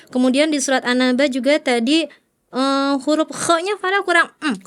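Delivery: usual speaking voice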